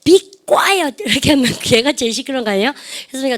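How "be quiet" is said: loud noisy shush. Shown as a demonstration. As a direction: bark "Be" loudly, like a noisy kid shushing others. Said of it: "더 크게 시끄럽게".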